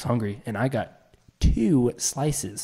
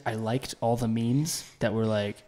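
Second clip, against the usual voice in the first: usual speaking voice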